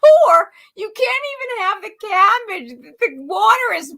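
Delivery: high, incredulous outcry; shocked and amused. Real, not acted. Mocking the poor family.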